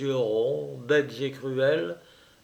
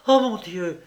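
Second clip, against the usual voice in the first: a sudden raised cry of alarm; startled cry; 5 semitones higher, 4 dB louder than usual. A narrator's reading. Juliette remembering her dog, a startled raised cry. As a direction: gasp it out, jumping up. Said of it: "s'écria Juliette".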